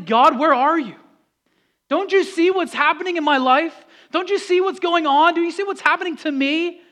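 loud, high plea to God; anguished outcry. Acted. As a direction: call out in frustration, demanding to be noticed. Acted desperate cry to God.

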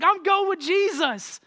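high, slow and eager; excited. Retold or imitated. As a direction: proclaim it with a leap of joy. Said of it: "he was excited".

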